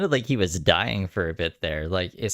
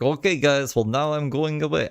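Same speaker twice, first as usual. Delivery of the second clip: put-on half-hearted Norwegian accent, higher and lifted; a rough try at Anja's Norwegian lilt; imitating a Norwegian streamer signing off, a little higher; Norwegian accent attempt; 6 semitones higher than usual. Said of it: "I can't even do her accent".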